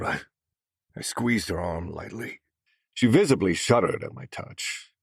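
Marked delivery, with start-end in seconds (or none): none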